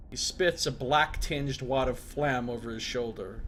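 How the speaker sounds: ordinary delivery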